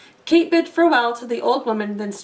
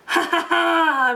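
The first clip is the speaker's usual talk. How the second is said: slow booming giant laugh; giant's laugh; 5 semitones higher, slower than usual. A narrator's reading. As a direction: gloat over the golden egg. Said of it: "roared the giant".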